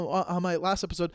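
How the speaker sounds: ordinary talk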